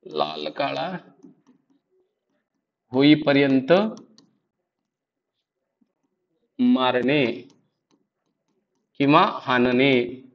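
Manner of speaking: low, slow dictation with long pauses. Shown as a demonstration. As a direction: dictate it piece by piece, pitch dropped. Dictates the meaning for writing down.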